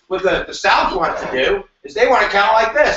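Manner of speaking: normal talking voice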